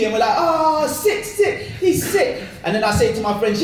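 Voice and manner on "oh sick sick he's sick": high, drawn-out amazement; thrilled kids. Acted. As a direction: gush like an awestruck boy. Kids marvelling at the wheelie.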